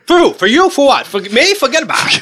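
brash Brooklyn tough-guy swagger; cocky Noo Yawk comic; Andrew Dice Clay. Retold or imitated. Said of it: "Andrew Dice Clay".

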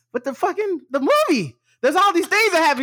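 exasperated protest. Retold or imitated. Arguing with Keith's nitpicking of the movie, exasperated.

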